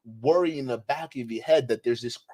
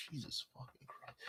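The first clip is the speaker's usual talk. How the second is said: breathy, soft, despairing under the breath; appalled whisper; 25 dB quieter than usual. Real, not acted. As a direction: whisper it in disgust. Appalled sigh after clip.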